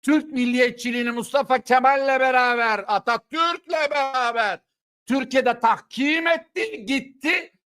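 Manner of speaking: slow, high, shouted sermon lines; fiery preaching. Acted. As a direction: hammer each phrase like a preacher at full force. (ateşli, yüksek sesle vaaz eder gibi)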